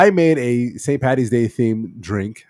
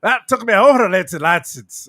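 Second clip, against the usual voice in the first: high, loud mock-Irish lilt; attempted Irish accent; 8 semitones higher, 5 dB louder than usual. Shown as a demonstration. Host attempts Irish for the tipsy guests, mock Irish accent. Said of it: "how to do it with the Irish accent".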